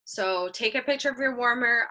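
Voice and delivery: normal talking voice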